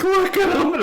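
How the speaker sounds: high voice breaking into laughter; laughing mock wail. Acting the wife in the corner, laughing mock wail. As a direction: squeal it high while laughing.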